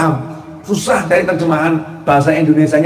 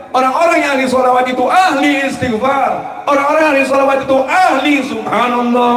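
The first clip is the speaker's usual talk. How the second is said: higher, fervent listing with shouted peaks; fervent preaching; 6 semitones higher than usual. Acted. Preacher praising those devoted to solawat, fervent rising preaching. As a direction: build the list up like a preacher at full force. Preaching list at full force.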